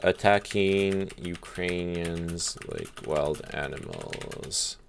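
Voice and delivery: a soft, slow reading of the quote; slow quoting. Retold or imitated. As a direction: drag the words out softly, as if checking them while typing.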